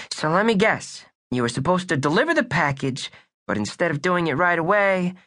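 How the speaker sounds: put-on wisecracking boy detective voice; tough kid detective. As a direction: play it like a pint-sized noir detective.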